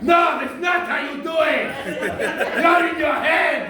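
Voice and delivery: high, loud, drawn-out mock shout; imitated Russian guy. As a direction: yell it like the Russian heckler at the back. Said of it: "had this Russian guy in the back".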